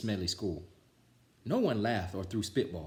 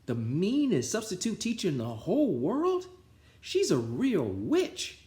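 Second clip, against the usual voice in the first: put-on high, slow spooky kid voice; a kid warning the new boy about the meanest substitute; scary kid tale; 10 semitones higher, 3 dB louder than usual. A narrator's reading. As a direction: tell it like a ghost story. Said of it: "said the first kid".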